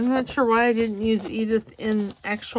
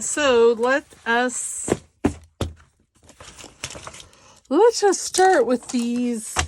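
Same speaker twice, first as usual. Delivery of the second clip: slow and drawn out; 3 semitones higher, 6 dB louder, slower than usual. Real, not acted. Drawn-out announcement.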